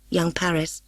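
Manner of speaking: usual speaking voice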